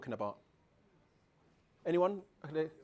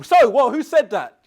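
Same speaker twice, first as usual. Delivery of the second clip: very loud, raised pitch; sudden attention-grabbing voice; 4 semitones higher, 17 dB louder than usual. Shown as a demonstration. Speaker checking who was sleeping, sudden loud call. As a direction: burst out to startle any sleepers. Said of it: "see how I use the voice?".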